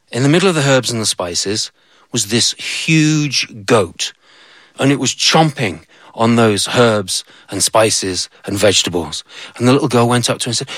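plain reading voice

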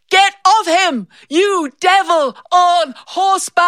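put-on high, passionate child's shout; ten-year-old hurling her best insult; cried out. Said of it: "I cried out".

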